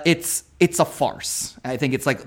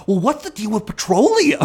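dumb firefighter; 8 semitones higher than usual. Retold or imitated. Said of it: "he's just, like, a dumb firefighter".

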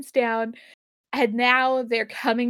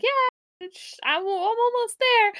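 Scotty voice; 10 semitones higher than usual. Retold or imitated. Scotty voiced, strained high.

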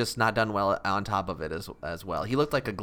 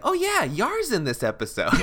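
surprised; 11 semitones higher, 3 dB louder than usual. Retold or imitated. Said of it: "I was like".

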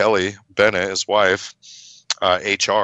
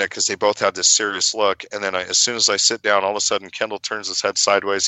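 ordinary delivery

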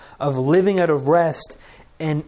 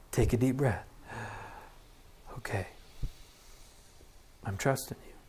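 hushed breathing prayer; 5 semitones lower, 11 dB quieter than usual. Shown as a demonstration. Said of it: "breathing exercise prayer".

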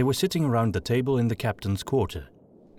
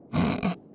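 a short scornful snort; snort of laughter; 10 semitones higher than usual. A narrator's reading. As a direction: snort at the dim remark. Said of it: "Gustav snorted".

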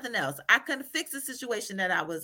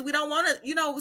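ordinary talk, nothing marked

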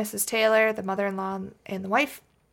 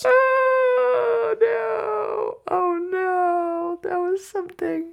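high squealing "oh no" while laughing; cringe-laugh, pitched way up; laughing cry; 10 semitones higher, much slower than usual. Real, not acted. Reacting to a failed accent.

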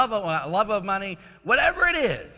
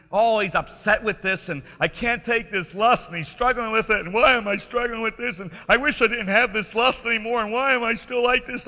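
whining lament; 6 semitones higher, 5 dB louder than usual. Retold or imitated. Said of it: "He's crying".

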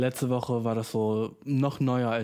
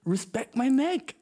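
mock angry retort; 11 semitones higher, slower than usual. Repeats retort.

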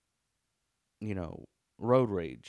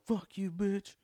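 high-pitched imitation of a road-raging woman; high mock yell, not full volume; 10 semitones higher than usual. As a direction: mimic a yelling driver, pitched well up. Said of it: "some lady who was yelling".

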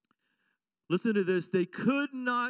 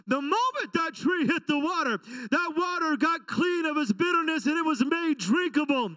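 higher, louder, crying out; preaching at full force; 7 semitones higher, 5 dB louder than usual. Acted. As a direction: build to a shouting climax. Climactic preaching.

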